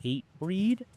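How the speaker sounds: high-pitched questioning repeat, rising at the end; incredulous echo. Retold or imitated. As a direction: imitate friends taken aback by the band name. Friends react doubtfully to band.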